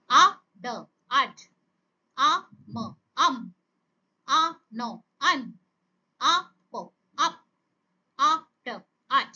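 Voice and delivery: two separate sounds joined into one, as in আ... ম... আম; phonics sound-out. Shown as a demonstration. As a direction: say আ, pause, ড, pause, then blended আড. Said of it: "লেটারের sound গুলোকে কি করছি". (থেমে থেমে আ, ড, তারপর একসাথে আড)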